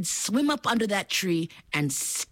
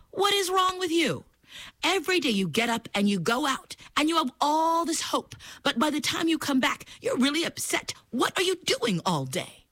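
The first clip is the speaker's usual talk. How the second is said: higher scolding mother voice; crocodile mother; 9 semitones higher than usual. Acted. Mother crocodile scolding her grumpy son, higher voice. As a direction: ask like a worried, exasperated mom. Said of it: "his mother said to him".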